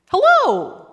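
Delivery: high, loud smiling hello; bright calling out. Acted. Lazy bird calls to the passing elephant, high smiling call. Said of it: "called the lazy bird, smiling her best".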